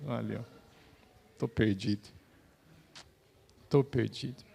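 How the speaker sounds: broken, cutting-out signal; radio breaking up. Real, not acted. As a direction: voice dropping out on a bad line. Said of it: "Tá cortando tudo isso". (sinal de rádio falhando)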